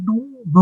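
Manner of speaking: নু, ন forced through a blocked nose; nose pinched. Shown as a demonstration. Teacher demonstrates nasal letters, nose pinched. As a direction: attempt নু, ন with nostrils held shut. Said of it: "নাক টিপে".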